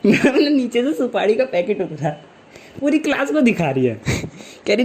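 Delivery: ordinary delivery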